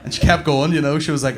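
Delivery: normal talking voice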